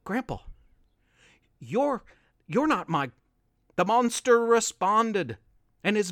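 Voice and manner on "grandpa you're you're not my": quivering. A narrator's reading. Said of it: "a quivering".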